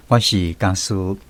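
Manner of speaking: ordinary talk, nothing marked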